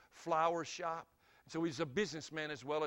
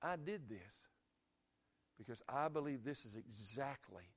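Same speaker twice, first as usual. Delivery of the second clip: gentle answer; 4 semitones lower, 7 dB quieter than usual. Retold or imitated. Dad's gentle answer to repentant man.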